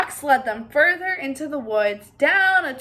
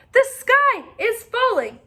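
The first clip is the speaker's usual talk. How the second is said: high, loud alarm cry; 10 semitones higher, 8 dB louder than usual. Acted. Henny Penny and Ducky Lucky cry out to Turkey Lurkey, high and loud. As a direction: shout the warning. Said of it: "cried Henny Penny and Ducky Lucky".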